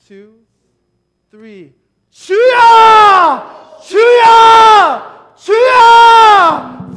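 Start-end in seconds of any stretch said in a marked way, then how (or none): 2.1-7.0 full-voiced, high, slow cry of 주여; 주여 shouted, long and high; shouting 주여. Real, not acted. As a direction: cry out 주여 with everything you have. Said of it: "practice of shouting biblically to God".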